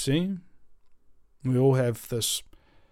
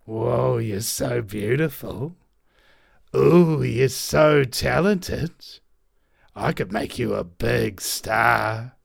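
sleazy flatterer; 2 semitones lower than usual. Acted. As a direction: sweet-talk the cell to win it over. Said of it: "the free radical goes up to the cell and goes".

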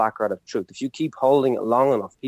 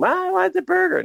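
mock child's scream, very high pitched. Mocking guards who demand like children, high mock child scream. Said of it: "like a child screaming".

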